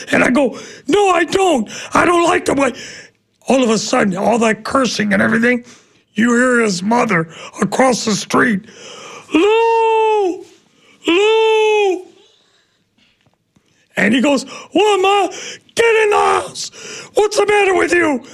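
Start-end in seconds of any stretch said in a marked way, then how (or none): 0.9-2.7 high, defiant shout back. Retold or imitated. Batter shouting back at the pitcher, high defiant. 9.3-12.1 high, far-off shouted call of a name. Retold or imitated. Mother shouting for her son from across the street, high call. 14.7-18.2 high, irritated shout; shouting back. Retold or imitated. Son shouting back at his mother, high irritated yell.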